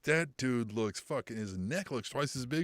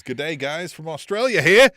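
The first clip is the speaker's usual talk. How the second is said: put-on terrible Australian accent, rising loud; bad Aussie accent, loud at the end; 3 semitones higher, 11 dB louder than usual. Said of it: "my terrible Australian accent".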